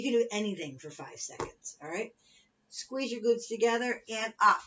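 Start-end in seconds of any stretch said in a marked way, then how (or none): 2.7-4.6 aerobics instructor. Acted. Fitness cueing and countdown.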